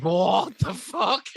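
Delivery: high, drawn-out incredulous outcry; hammed-up shock; shocked disbelief. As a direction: stretch it out, appalled and half laughing. Retold shocked reaction to chat-up line.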